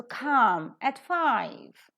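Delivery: very slow, come aspirated, five stressed, at weak; slow model with weak at. Shown as a demonstration. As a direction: spell it out slowly, at reduced. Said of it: "five is stressed".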